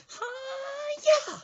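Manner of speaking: very high, loud attack cry; shrieked karate chop shout; mock karate yell. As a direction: imitate her battle cry in falsetto. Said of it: "grabs this big tub of ice cream and goes".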